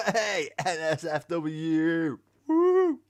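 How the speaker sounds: hammed-up cheer of a viewer expecting NSFW; mock excited cheer. Streamer imitates viewers cheering for NSFW, loud mock excitement.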